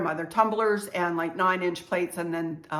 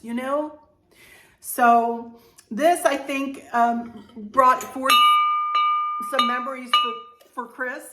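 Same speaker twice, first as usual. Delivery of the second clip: real emotion, higher voice with halting pauses; choked up; 5 semitones higher, 4 dB louder than usual. Real, not acted. Vlogger remembering loved ones to viewers, choked up. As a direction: fight back tears while getting the words out. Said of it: "sorry, I'm gonna cry".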